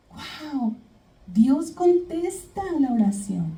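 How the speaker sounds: high, hushed wonder; awed amazement. Retold or imitated. [name] retold seeing her prayer answered, awed amazement. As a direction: voice a soft, high-pitched wow of amazement.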